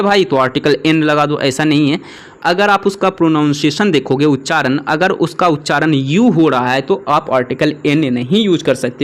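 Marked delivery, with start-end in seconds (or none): none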